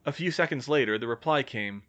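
ordinary delivery

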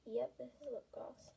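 whispered aside; whispering. Real, not acted. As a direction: whisper it to yourself. Quiet aside while checking product.

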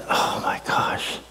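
imitating a hurting man in a hushed whisper; pained whisper. Pastor imitates the limping store worker, whispering in pain. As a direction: breathe it out like it hurts.

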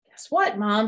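high eager guess what; Froggy proud to tell mom; eager news. Acted. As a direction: burst with news. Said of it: "said Froggy".

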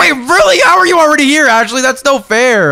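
high, loud disbelief; startled protest at her arrival; surprised. Real, not acted. To Ashley who arrived too soon, surprised cry. Friend arrives in world early.

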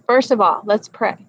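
usual speaking voice

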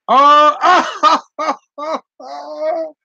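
real laughter breaking through the words; high laughing oh, oh, oh. Real, not acted. Cracking up at Donna's comment.

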